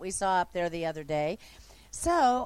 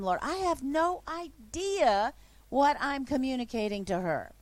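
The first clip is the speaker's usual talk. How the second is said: usual speaking voice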